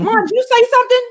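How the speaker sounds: high, loud, urgent question to mom; excited disbelief. Retold or imitated. Daughter asks her silent mother if she spoke, high eager call.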